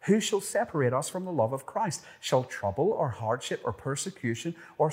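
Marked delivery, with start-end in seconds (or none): 0.1-2.0 higher, fast, urgent who; excited question. Real, not acted. Preacher repeating the third question with rising urgency. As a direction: fire the question out quickly. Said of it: "Third question".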